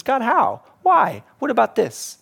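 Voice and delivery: high pleading "God, how? Why?"; a hint of a complaint cried to heaven; protesting to God. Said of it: "He says".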